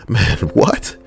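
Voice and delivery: ordinary delivery, nothing marked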